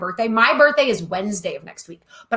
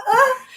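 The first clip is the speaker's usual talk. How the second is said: mock scream; 13 semitones higher than usual. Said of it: "like, just with all".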